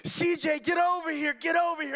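high panicked shouting; frantic yell. Retold or imitated. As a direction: yell at the dog to come back. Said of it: "And I'm yelling".

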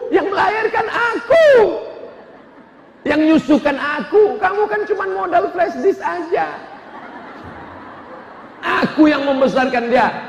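hammed-up wife nagging her husband; scolding wife. (istri mengomeli suami yang menangis, suara tinggi)

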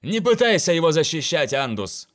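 loud mock protest to a friend; exclaiming. A narrator's reading. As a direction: call out in playful protest. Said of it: "воскликнул Тимитер".

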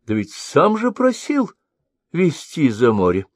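put-on high, cackly-toned old crone, peering and suspicious; old woman voice. A narrator's reading. Old woman questions the sorcerer, high suspicious crone voice. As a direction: play the half-blind old woman sizing him up.

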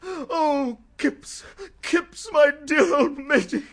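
loud, high, joyous greeting; bubbling, emotional delight; overjoyed. Acted. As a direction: gush over your old friend. Overjoyed actor greeting friend.

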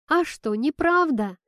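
high, loud challenge; insistent child's retort. A narrator's reading. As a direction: push back, insisting on being right. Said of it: "настаивала Кира".

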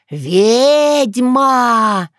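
high, long-drawn spooky cry; scary voice. A narrator's reading. Dunya naming what the yaga is, in a scary voice. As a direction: stretch it out as a frightening pronouncement. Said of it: "произнесла страшным голосом".

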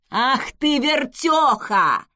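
loud, drawn-out scolding yell; angry yell. A narrator's reading. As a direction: roar down at her. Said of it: "заорал он на неё сверху".

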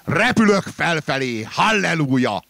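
loud, slow exultant cry; slow, loud hallelujah of delight; rapturous shout. Acted. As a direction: shout it like a joyful hymn. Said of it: "kiáltottam".

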